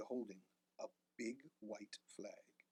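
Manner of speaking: usual speaking voice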